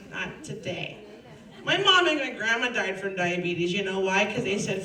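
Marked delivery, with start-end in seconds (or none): 0.1-1.0 Not today dropped much softer, falling away; hushed repeat. Shown as a demonstration. To Satan again, a much softer not today. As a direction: repeat Not today almost under breath. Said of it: "Nay, nay, Satan".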